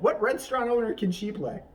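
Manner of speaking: normal talking voice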